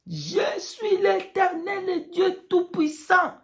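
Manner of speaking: slow, high, crying proclamation; voice of God. Pretend. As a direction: proclaim slowly as the Almighty. God's voice in vision testimony.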